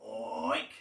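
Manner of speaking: one long, very low oink; pig oink. A narrator's reading. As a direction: drop down into a single drawn-out oink. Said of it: "All she could hear was".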